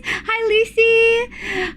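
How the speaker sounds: high, very slow sing-song hello; cutesy coo. Real, not acted. Streamer cooing at a chatter, high and very slow. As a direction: draw the name out sweetly.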